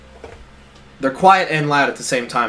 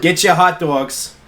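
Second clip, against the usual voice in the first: slow, raised Jersey vendor call; Jersey accent; 4 semitones higher, much slower than usual. Pretend. Playing a hot dog seller, in a Jersey accent. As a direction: hawk it in mock Jersey. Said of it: "say in a Jersey accent".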